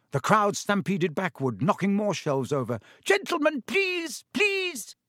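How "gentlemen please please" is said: very high, pleading shop assistant; desperate cry. A narrator's reading. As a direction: squeal for the brawlers to stop. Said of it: "cried the assistant".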